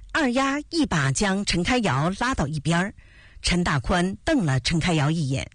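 plain reading voice

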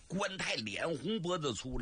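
ordinary delivery

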